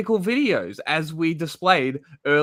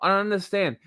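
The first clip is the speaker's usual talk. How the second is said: normal talking voice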